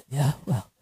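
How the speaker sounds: acted weak, gasping phone voice; breathless talk. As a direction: puff between the words as if winded. Acts out her earlier breathless phone voice.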